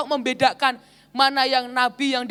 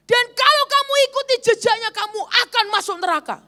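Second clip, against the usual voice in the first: much higher, pushy threatening scold; angry scolding; 9 semitones higher than usual. Retold or imitated. Prophetess threatening the pastor with hell, mock angry. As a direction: warn her of hell in a half-acted angry voice. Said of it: "sambil marah-marah".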